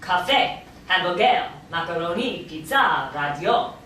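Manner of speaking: Israeli accent, last syllables stressed. Shown as a demonstration. Teacher shows student how words become Hebrew, Israeli accent. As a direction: say each word the Israeli way, end-stressed. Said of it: "When you say them with an Israeli accent".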